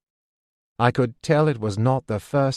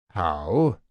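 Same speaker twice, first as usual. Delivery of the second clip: low, suspicious question; 5 semitones lower than usual. A narrator's reading. Man questioning how she paid, low and suspicious. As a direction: probe with a lowered, wary voice. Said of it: "he asked suspiciously".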